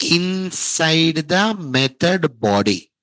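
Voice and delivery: inside the method body spelled out slowly, word by word; slow, emphatic. Shown as a demonstration. Teacher telling students where exception will occur, slow and emphatic. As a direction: stretch each word of the answer.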